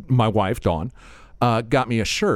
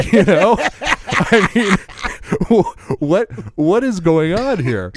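much higher and louder, baffled; loud exasperation; 8 semitones higher, 5 dB louder, much slower than usual. Real, not acted. Host puzzled by his own dream, loud exasperation. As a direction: throw up your hands, baffled. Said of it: "exasperated at dream".

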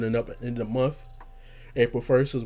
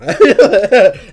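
high loud cackle; loud laughter; 10 semitones higher, 17 dB louder than usual. Real, not acted. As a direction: lose it laughing. Laughing fit continues.

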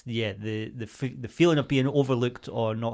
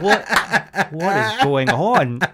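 incredulous; 5 semitones higher, 6 dB louder, much slower than usual. Retold or imitated. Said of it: "I was like".

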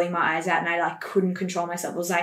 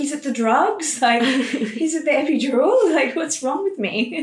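panicked; 7 semitones higher, 3 dB louder, slower than usual. Retold or imitated. New mother panicking about no tears.

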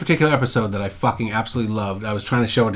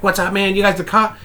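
higher-pitched strung-out guy impression; wired weirdo; 7 semitones higher than usual. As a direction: imitate a jittery oddball on something. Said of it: "He's all fucking weird".

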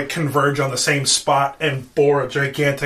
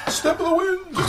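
put-on high call; 3 dB quieter than usual. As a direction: sing out the move in falsetto. Calls out a move in put-on voice.

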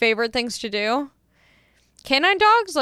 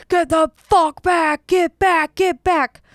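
loud, higher mock cop shout; imitated yelling; 6 semitones higher, 5 dB louder, slower than usual. Cop to approaching driver asking about her street, imitated yelling. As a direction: bark it like an officious cop. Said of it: "he kept yelling".

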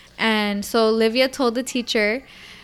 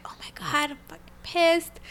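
hushed, higher-pitched fury; retold seething; 6 semitones higher, 5 dB quieter than usual. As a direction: seethe under your breath, anger held in. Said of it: "my blood boiling".

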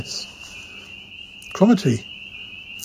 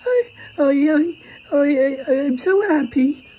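very high, stammering joy; overcome, halting high-pitched delight; tearful happiness; 12 semitones higher than usual. Acted. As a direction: choke up with happiness, voice squeaking high. Mother overcome by family reunion result.

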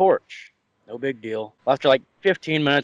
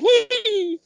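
put-on short squeaky drunk giggle; high giggle. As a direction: let out a tiny high giggle. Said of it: "giggled as he landed".

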